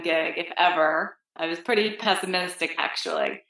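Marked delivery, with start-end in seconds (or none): none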